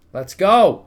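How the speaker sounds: big excited yell, far up in pitch; hype shout. Real, not acted. Streamer hyping his army into war, loud shout. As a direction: roar it out as the war starts.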